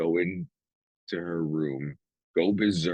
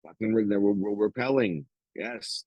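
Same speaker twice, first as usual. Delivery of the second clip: ordinary talk, nothing marked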